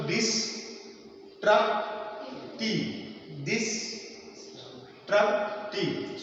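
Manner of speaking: "destructive" in three isolated syllables, long gaps between. Shown as a demonstration. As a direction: sound out "destructive" very slowly, des, truc, tive. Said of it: "So there are three syllabic".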